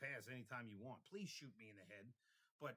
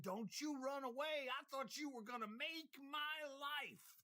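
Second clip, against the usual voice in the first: much higher, loud, slow yell; screaming after him; 13 semitones higher, 6 dB louder, slower than usual. Retold or imitated. To the man running away, a high mock scream. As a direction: scream after him as he flees, half joking. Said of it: "I screamed bloody murder".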